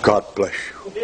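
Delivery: low-pitched, lightly put-on pious blessing; wolf posing as pious. Preacher imitates the wolf people should look out for, mock pious. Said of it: "That's a wolf".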